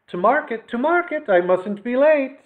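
put-on high, sing-song old lady hurrying; lilting little market chant; old woman bustling off to market; Missus McTats. A narrator's reading.

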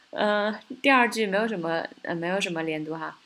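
normal talking voice

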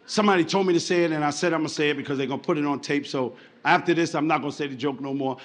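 low, soft, quick explanation; confiding aside. Real, not acted. Comedian confiding about a joke, low quick aside. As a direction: drop down and rattle off the disclaimer.

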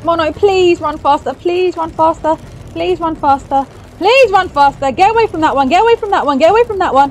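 loud, high, frantic begging; desperate repeated cries, high and loud; panicked pleading. Real, not acted. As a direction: urge someone to flee for their life. Urging character to flee monsters.

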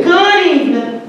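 high, sing-song Good evening; grandma's greeting. Retold or imitated. Grandson imitating grandma's sunny phone greeting. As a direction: lift up on your toes and sing the greeting. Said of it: "she would say".

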